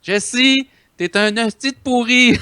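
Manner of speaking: high-pitched French put-down, performed on request; insulting in French. Pretend. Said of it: "tell Jesse in French just how horrible he is".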